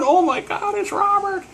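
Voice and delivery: very high, mock scream; screaming fangirl. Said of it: "this girl is screaming".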